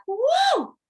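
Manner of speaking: high, loud whoop of joy; ecstatic woo. Retold or imitated. Vlogger reliving her bliss, ecstatic loud whoop. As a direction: cheer like you are on top of the world.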